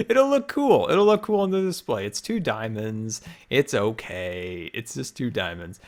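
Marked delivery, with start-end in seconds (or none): 0.1-2.1 excited. Real, not acted. Excited after teasing.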